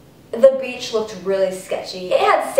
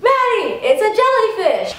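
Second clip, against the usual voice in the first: alarmed shout; 10 semitones higher than usual. Retold or imitated. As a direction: yell to warn her from the beach. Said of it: "my friends are like".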